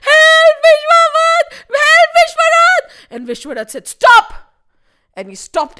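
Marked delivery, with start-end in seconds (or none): 0.1-2.9 very high, screaming cry for help; shouted plea. A narrator's reading. Trishanku calls Vishwarath while falling from heaven, shouted plea. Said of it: "He shouted". 3.9-4.3 high, explosive order; shouted command. A narrator's reading. Vishwarath stops Trishanku in midair, shouted command. Sage halts the fall.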